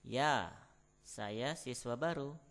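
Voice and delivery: usual speaking voice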